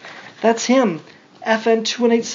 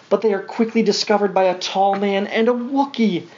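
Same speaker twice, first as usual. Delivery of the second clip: ordinary delivery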